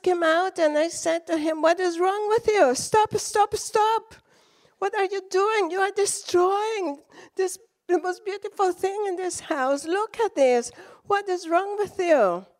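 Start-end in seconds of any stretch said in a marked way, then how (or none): 1.6-12.5 high, urgent pleading to stop; frantic protest. Retold or imitated. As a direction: protest in alarm over the roses. Retold quarrel, yelling at husband.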